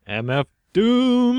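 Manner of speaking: slow, high hype-man call of the name; slow high hype shout of a rapper's name; mock hype.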